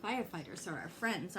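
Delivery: ordinary delivery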